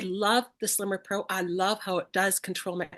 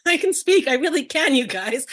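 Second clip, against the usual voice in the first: laughing through the words, high and loud; amused relief, loud and high; laughing at herself; 6 semitones higher, 9 dB louder than usual. Real, not acted. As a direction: giggle it out after tripping on a word. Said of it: "oh my goodness".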